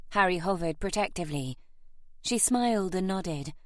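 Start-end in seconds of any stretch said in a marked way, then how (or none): none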